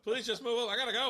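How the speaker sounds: high, loud pleading through amusement; hammed-up panic, desperate to get away; frantic mock plea. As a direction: plead urgently, playing it for laughs. Imagined urgent plea in drive-thru.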